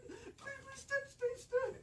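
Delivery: high, frantic pleading with the Jeep; high panicked please start; desperate pleading. Retold or imitated. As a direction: beg the engine to start, voice up high.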